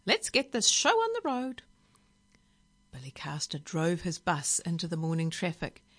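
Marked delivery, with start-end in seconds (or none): 0.0-1.6 high, bossy bird; parrot voice. A narrator's reading. Parrot urging the driver to set off, bossy bird voice. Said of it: "it said".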